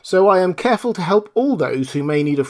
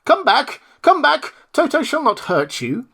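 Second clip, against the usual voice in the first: higher, louder call; 13 semitones higher, 3 dB louder than usual. Acted. Woodman holding Toto calls to the scattered mice, calling out. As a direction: call the mice back kindly.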